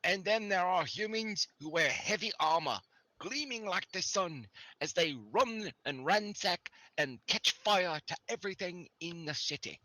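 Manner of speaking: a frightened local describing the attackers; NPC storyteller voice. Acted.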